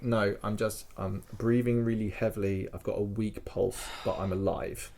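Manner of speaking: low, soft, faint reply; weak patient. Retold or imitated. Francis assuring doctors he's not dead, weak and low. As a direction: answer with little strength left.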